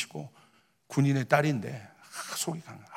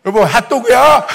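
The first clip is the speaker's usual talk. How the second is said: loud, high, oblivious husband's question; clueless eager question; 8 semitones higher, 14 dB louder than usual. Retold or imitated. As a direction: call it out to your wife, missing the mood. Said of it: "제가 눈치도 없이". (눈치 없이 크고 높게 외치는 질문)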